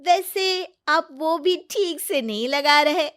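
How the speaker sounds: high, loud, drawn-out tease; playful teasing. Acted. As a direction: needle grandpa playfully and slowly. Granddaughter teases grandfather. (ऊँची आवाज़ में शरारती छेड़छाड़)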